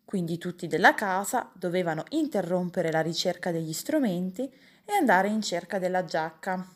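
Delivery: plain reading voice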